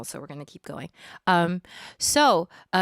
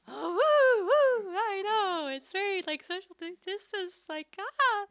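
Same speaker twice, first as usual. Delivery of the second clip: much higher squealing woo; high, slow mock wail; mock shriek; 13 semitones higher, slower than usual. Squealing mock shock, social distance.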